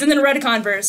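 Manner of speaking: normal talking voice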